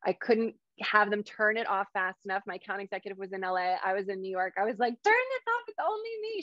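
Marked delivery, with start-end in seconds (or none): 5.0-6.4 much higher, panicked mock urgency; frantic plea. Hypnotist to her account executive, panicked high-pitched plea. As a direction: beg them to shut it off, overwhelmed.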